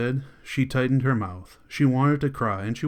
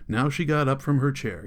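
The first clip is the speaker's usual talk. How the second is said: ordinary delivery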